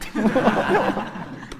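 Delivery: a full laugh at his own joke; hearty laugh. Real, not acted. Laughing at his Sundanese punchline.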